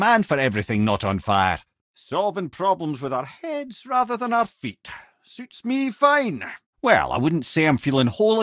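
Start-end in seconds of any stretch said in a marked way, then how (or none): 1.9-6.4 mimir line. Acted. Game voice lines, Mimir and Brok.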